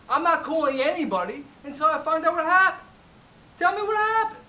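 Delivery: loud, high shout, building; angry demand. Acted. Owner refusing client's request to call her, shouting angrily. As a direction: yell it, fed up and insistent.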